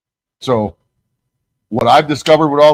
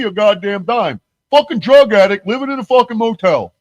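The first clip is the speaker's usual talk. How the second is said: very high, louder mock rant; mocking Flax ranting; 10 semitones higher, 3 dB louder than usual. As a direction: whine the complaint in his voice. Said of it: "He goes".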